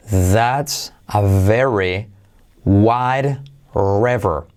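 very slow English model sentence, every word spelled out; slow demo. Shown as a demonstration. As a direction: read the sentence out slowly for learners. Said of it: "Frase de práctica". (frase de práctica muy lenta)

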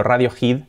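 "Radiohead" as RA-dio-jid; Spanish-style ra-dio-HID with raspy j; Spanish pronunciation. Shown as a demonstration. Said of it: "Se tiende a decir".

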